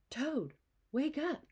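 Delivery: high, slow wake-up call. A narrator's reading. Frog calling Toad awake at his door. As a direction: call him out of bed. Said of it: "he cried".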